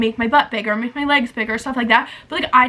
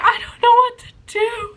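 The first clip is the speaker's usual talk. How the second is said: high-pitched helpless whine; exaggerated tearful plea, acted out; mock wail; 10 semitones higher than usual. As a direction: wail it like a distraught caller, hammed up. Retold distraught call to partner.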